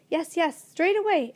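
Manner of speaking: crowd shout. A narrator's reading. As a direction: call it out together, eager. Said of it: "everybody shouted at once".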